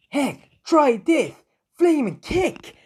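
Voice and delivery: high, slow battle yell; hammed-up hero attack call; battle cry. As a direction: shout the attack name like a hero powering up.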